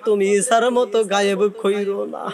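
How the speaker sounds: ordinary talk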